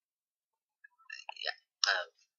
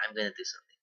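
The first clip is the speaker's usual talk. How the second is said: whispering; 10 dB quieter, much faster than usual. Real, not acted. Aside while dealing with choking.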